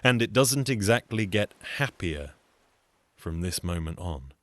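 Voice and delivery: ordinary delivery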